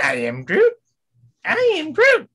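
high, loud cartoon Groot; Doofenshmirtz-like Groot. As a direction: squawk it like a mad scientist. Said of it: "You sound like Doofenshmirtz".

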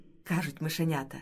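ordinary delivery, nothing marked

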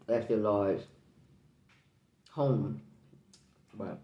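with food in mouth; eating while picking crab; talking while chewing. Real, not acted. Seen 3: Hands crack crab at mouth; fingers in mouth at start.

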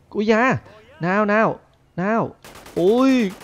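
short, high exclamations; loud, high bursts of excitement; excited yelps. Real, not acted. Throwing a prank bomb at teacher.

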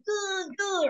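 a hint of a pious nod of approval. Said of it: "That's like".

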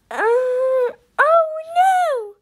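high, drawn-out oh no; gasped alarm. A narrator's reading. Chip sees the magic key glow, high gasped alarm. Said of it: "gasped Chip".

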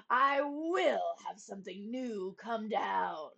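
slow, menacing royal threat; terrible rage. Acted. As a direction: vow it in a rage. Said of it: "flew into a terrible rage".

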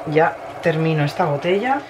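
normal talking voice

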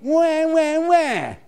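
high mock whimper; mock whining wah. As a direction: whine it out like a sulking child. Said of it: "He said".

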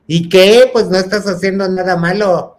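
loud, mocking challenge; loud retort. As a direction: call it out loud across the room. Friends shouting at party.

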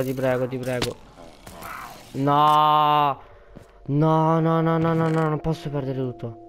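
desperate ti prego; panicked pleading. Real, not acted. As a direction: beg not to lose it all. About to lose everything.

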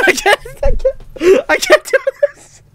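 very high, tearful squeak; terrified. Real, not acted. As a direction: squeal helplessly, close to crying. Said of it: "this is so scary".

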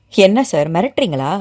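ordinary delivery, nothing marked

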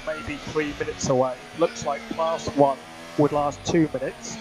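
crackly team radio transmission; race car radio. Acted. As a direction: talk like over F1 pit radio.

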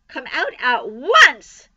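loud, slow, emphatic order; cried command. A narrator's reading. Mother ordering her son from the crate, crying out. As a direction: draw out the order at full voice. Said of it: "cried Missus Arable".

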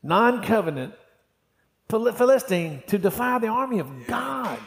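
high-pitched, defiant outrage; indignant, high, ringing rhetorical question; acted indignant challenge. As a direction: preach it as David's scornful challenge. Acts out David's challenge to Goliath.

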